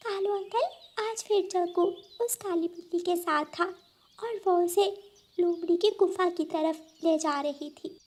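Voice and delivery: put-on high, soft child voice; a small bird telling what she saw; high quiet child voice; little girl bird.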